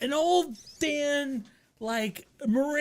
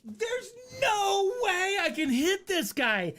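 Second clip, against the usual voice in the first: drawn-out higher "no way"; higher, slow disbelief; 7 semitones higher than usual. Retold or imitated. Teen in the car eyeing the jogger, drawn-out higher disbelief. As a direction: stretch out the doubt, pitched up. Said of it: "and I'm like".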